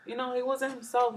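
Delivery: ordinary talk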